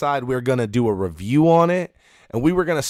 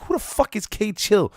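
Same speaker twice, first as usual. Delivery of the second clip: baffled high question; 9 semitones higher than usual. Retold or imitated. Retelling his own puzzled question.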